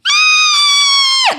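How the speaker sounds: imitated high toddler scream; long piercing mock shriek; screaming kid. Young kid screaming for no reason, shrill shriek. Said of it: "fucking screaming for no reason".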